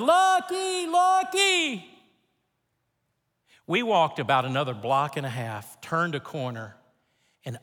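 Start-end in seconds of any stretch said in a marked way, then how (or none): 0.0-1.9 high, stretched call for a dog. Retold or imitated. Man on a bike calling for his lost dog, high and long. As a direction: sing out the name down the street. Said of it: "now he's going".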